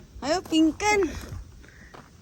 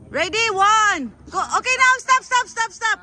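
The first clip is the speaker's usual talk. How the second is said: lifting together; 7 semitones higher, 6 dB louder than usual. Real, not acted. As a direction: call the lift for the team. Group lift, calling the count.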